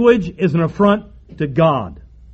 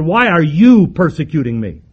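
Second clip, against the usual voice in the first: "you" punched louder; stress on YOU; 2 semitones lower, 3 dB louder than usual. Acted. The Lord confronting Saul personally, stress on "you". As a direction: lean hard on "you", singling him out. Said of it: "deals with him particularly".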